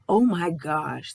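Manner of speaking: ordinary talk, nothing marked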